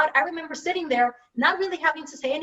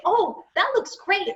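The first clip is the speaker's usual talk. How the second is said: higher, drawn-out delight at an outfit; pleased shopper; 6 semitones higher, slower than usual. Acted. Imagined shopper admiring an outfit in a catalog, pleased. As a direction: slowly admire it with a lifted pitch.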